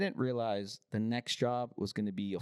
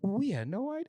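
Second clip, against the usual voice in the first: mock innocent customer; 9 semitones higher than usual. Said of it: "And then they're like".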